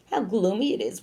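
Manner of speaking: ordinary delivery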